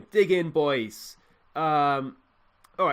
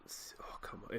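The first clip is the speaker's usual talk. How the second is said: breathless whispered plea; tense whisper; 18 dB quieter than usual. Real, not acted. Gamer urging his team in a tense whisper. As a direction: whisper it under your breath, willing it in.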